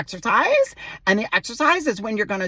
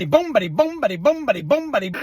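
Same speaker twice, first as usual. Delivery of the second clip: rhythmic bouncing massage chant; comic thumping sing-song; massage noises; 4 semitones higher, faster than usual. Acted. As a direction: pound out each beat like massaging hands. Acting out the massage.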